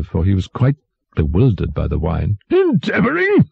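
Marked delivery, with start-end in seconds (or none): 2.5-3.5 very high incredulous cry; fierce tyrant. A narrator's reading. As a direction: throw the word back fiercely. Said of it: "cried the tyrant fiercely".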